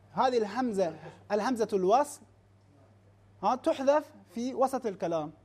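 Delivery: ordinary talk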